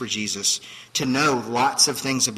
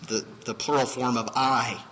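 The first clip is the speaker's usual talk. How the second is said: ordinary talk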